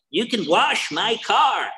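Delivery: high, drawn-out heckle; imitated yell from the crowd; mock heckler yell. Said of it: "some asshole from the back yells out".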